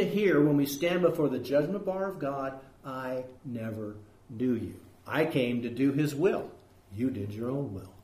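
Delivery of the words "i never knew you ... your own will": hushed, grave verdict. Acted. Jesus quoted rejecting false followers.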